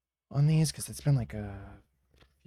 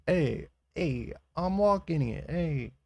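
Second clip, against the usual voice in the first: louder hammed-up New Yorker; New York accent; 5 semitones higher, 5 dB louder, slower than usual. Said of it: "Or, like, New York, like".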